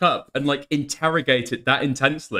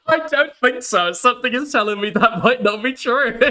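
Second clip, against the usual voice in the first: laughing; 7 semitones higher, 3 dB louder than usual. Real, not acted. Laughing mock reply.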